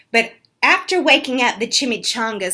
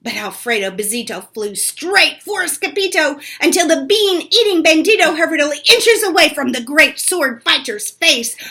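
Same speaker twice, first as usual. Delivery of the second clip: ordinary delivery, nothing marked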